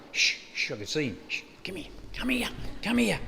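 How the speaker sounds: hushed urgent whisper, barely voiced. Max hiding behind the tree, beckoning the boy in a whisper. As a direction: whisper him over so nobody hears. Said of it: "Max is going".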